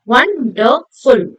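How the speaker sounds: 'wonderful' slow, as won, der, ful; syllable by syllable. Shown as a demonstration. Teacher recapping three syllables for students, syllable by syllable. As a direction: space out each beat of 'wonderful'. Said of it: "Three syllables".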